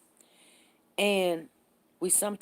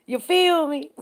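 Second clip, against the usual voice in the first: Vito voice; 9 semitones higher, 8 dB louder than usual. Retold or imitated. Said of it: "in my Vito voice".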